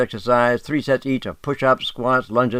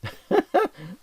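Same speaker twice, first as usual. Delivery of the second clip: high laugh; 11 semitones higher than usual. Real, not acted. Laughing at own point.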